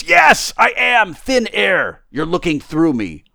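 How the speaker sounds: loud, high burst easing downward; emphatic disembodied voice losing patience; exasperated unseen voice. Acted. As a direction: insist forcefully, then settle slightly. Voice exasperated, insists on invisibility.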